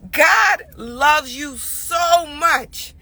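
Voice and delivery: slow, tearful; God loves you, choked up and slow; real crying. Real, not acted. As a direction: let the tears come through each word.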